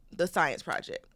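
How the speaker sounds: usual speaking voice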